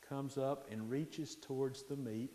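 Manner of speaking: usual speaking voice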